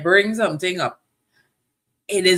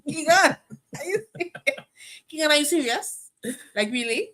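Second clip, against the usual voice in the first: high-pitched, incredulous questioning; high, challenging "are you serious" tone; voice going up; 10 semitones higher than usual. Real, not acted. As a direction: pitch it way up in disbelief. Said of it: "Anytime you hear the voice go up".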